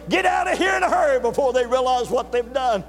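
high, loud acted-out warning; urgent shout. Border guard warns traveller to flee, in an urgent shout. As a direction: holler it to hurry someone away.

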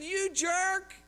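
high, sharp mock jab; annoyed insult. Driver insulting another driver, mock annoyed. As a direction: fling it at the other driver. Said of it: "And I said".

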